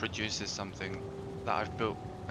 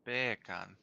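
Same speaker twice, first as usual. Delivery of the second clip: beer can with less stank on the R; Jamaican accent. Shown as a demonstration. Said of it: "too much stank on the R".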